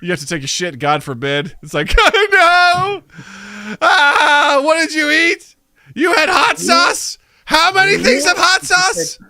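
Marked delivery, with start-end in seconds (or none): 2.0-9.2 high, loud, crying shout; horrified toilet. Pretend. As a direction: wail in disgusted horror.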